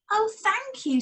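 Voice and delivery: high, grateful dog voice; the dog thanking Jed; grateful old dog. Acted.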